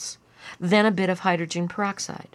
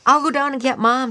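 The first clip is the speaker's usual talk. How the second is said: put-on higher, louder child's voice; eager child; 8 semitones higher, 8 dB louder than usual. A narrator's reading. Lyle offering to fetch Mom, eager. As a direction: pipe up loudly as an eager kid. Boy announcing in a story.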